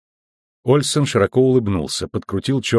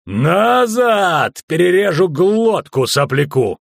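high, drawn-out shout; barked threat; 7 semitones higher, 3 dB louder, much slower than usual. A narrator's reading. Bald guard holding knife to boy, barking. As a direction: shout the threat to hold them off. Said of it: "рявкнул он".

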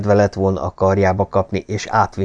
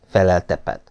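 ordinary delivery, nothing marked

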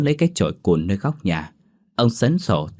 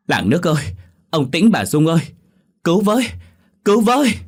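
high, loud cries building to a shriek; screaming for help; 10 semitones higher, 5 dB louder than usual. A narrator's reading. Mother shouting to neighbours for help, rising panicked scream. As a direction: call the whole village in panic. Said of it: "vừa hét om sòm".

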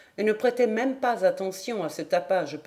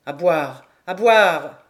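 put-on loud, stubborn drunk call, growing louder; insistent drunken demand; 3 semitones lower, 9 dB louder, slower than usual. A narrator's reading. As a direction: refuse and call for drink, drunkenly. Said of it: "Il secouait la tête, faisant".

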